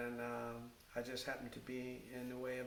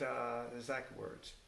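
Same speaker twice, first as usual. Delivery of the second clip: usual speaking voice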